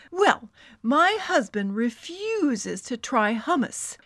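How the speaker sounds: outraged rant, leaning hard on key words; emphatic, drawn-out complaint. Acted. Friend rants about her husband refusing hummus, ranting. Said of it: "she ranted".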